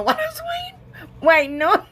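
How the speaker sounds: words rising high into a laugh. Real, not acted. Crafter laughing at her own editing slip, laughing. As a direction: break into giggles mid-sentence.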